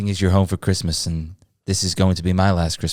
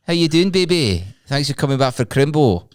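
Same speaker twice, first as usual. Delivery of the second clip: high old Scottish gran voice, lightly voiced; frail nana with a Scottish lilt; Scottish granny accent; 5 semitones higher than usual. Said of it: "How has she got that accent?".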